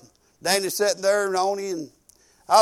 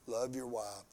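hushed inner thought; 10 semitones lower, 19 dB quieter than usual. Retold or imitated. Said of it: "I had the thought".

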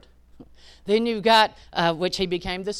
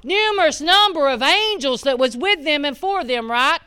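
higher and louder, preaching it hard; full force preaching; 9 semitones higher, 8 dB louder than usual. Acted. Preaching the prophet's prayer to the congregation, full force. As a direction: preach the miracle out to the church.

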